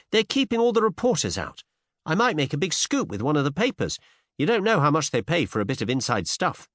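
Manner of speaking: put-on high, insistent young woman; persuasive Cinderella. A narrator's reading. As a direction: press him eagerly in a high voice. Cinderella coaxes him.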